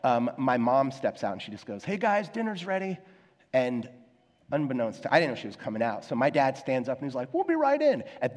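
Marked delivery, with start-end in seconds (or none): 1.8-3.0 lightly voiced, higher-pitched mom; mom calling out. Mom steps out to tell the guys dinner's ready, calling out. 7.3-8.0 high-pitched singsong call to someone indoors; calling back. Retold or imitated. Dad stands up to answer mom about dinner, calling back.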